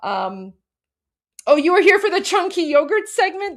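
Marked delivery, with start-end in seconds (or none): 1.5-3.6 delighted. Real, not acted. Delighted recognition of a viewer.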